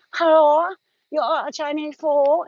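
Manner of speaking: high, slow put-on Chinese accent for a prank call; prank-call voice in a fake Chinese accent, drawn out; mock Chinese accent. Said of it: "I used to do Chinese accents".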